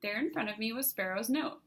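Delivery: plain reading voice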